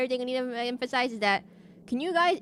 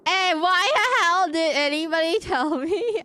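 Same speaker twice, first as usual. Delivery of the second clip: much higher and louder, laughing protest; loud indignant outburst; 8 semitones higher, 6 dB louder than usual. Real, not acted. Left out of the news, loud laughing protest. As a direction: complain loudly through a laugh.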